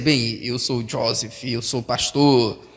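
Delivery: a lightly voiced smug preacher; hint of a pompous pastor; mock proud pastor. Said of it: "Se você disser".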